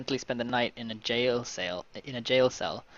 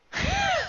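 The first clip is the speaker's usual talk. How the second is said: high burst of laughter; laughing; 3 dB louder than usual. Real, not acted. Player cracks up at the threat, high laugh.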